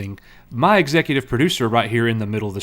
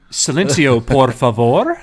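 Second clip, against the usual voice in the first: loud Spanish hush command; 7 dB louder, slower than usual. Acted. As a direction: order silence, loudly. Mock silencing in Spanish.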